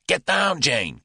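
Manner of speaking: high, loud warning; urgent cry. A narrator's reading. Julius warns Jane under gunfire, loud cry. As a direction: yell it as the shot rings out. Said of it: "cried Julius".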